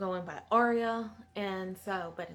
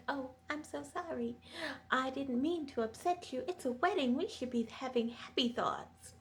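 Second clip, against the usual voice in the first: higher, sugary mock-innocent apology; fake sweet apology; 6 semitones higher than usual. Sansa mocking Miranda with fake sweet apology. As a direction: lay on a fake sweetness, lightly voiced. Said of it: "And she's like".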